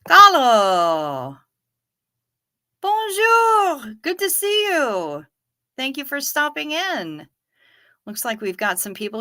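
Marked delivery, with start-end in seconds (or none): 0.1-1.4 loud cheerful call of a name. Real, not acted. Greeting someone arriving, calling out. 2.8-7.3 high, excited hello; bright greeting. Real, not acted. Cheery greeting to a visitor.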